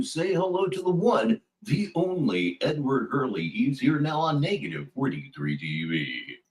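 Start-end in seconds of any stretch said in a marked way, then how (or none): none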